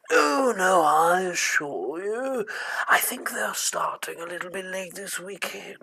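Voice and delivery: wavering, high-pitched spook's voice; second ghost. Acted. Ghost reassures its companion, high wailing ghost voice. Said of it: "the two ghosts flow past".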